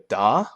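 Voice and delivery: "da" pitch climbing up sharply; rising tone da. Shown as a demonstration. Teacher repeats rising second tone da. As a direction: let "da" rise like hello. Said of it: "this is the same idea".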